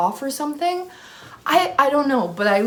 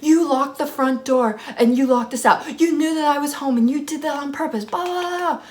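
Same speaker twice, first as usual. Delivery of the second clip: raised, high imitation of angry roommates; mock yelling; 7 semitones higher than usual. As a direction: mimic an accusing rant, trailing into blah-blah. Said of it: "freaking yelling at me".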